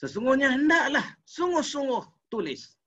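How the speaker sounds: "sungguh-sungguh" pitched high and insistent; emphatic intonation. Shown as a demonstration. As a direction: raise the pitch to stress "sungguh-sungguh". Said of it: "kita pakai intonasi je lah". (intonasi tegas pada "sungguh-sungguh")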